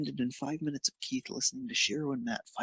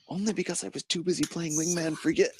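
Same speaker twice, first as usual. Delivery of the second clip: muffled; 6 semitones higher, 3 dB louder than usual. A narrator's reading. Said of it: "a muffled sounding".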